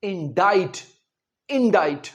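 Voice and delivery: इंडाइट said in-dite, no k sound; correct pronunciation twice. Shown as a demonstration. Teacher repeats for students: इंडाइट, in-dite. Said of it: "इसका सही pronunciation है".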